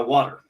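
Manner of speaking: water with a flapped t and an r at the end; American water. Shown as a demonstration. Showing how Americans say water, flapped t. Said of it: "how we say it in America".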